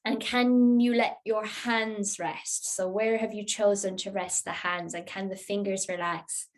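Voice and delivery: ordinary talk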